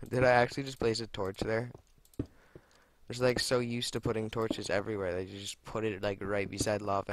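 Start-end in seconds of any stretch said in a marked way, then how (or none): none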